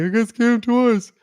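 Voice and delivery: drawn-out, high mock complaint; mock victim whine. Mocks a victim coming back complaining.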